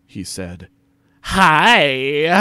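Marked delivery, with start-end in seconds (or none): 1.2-2.3 high, loud, stretched HI with a breath after; hammed-up flirty HI. A narrator's reading. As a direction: draw out HI flirtatiously. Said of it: "I replied flirtily".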